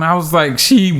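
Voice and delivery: normal talking voice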